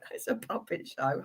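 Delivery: whispered aside. Real, not acted. Laughing aside about the puppet.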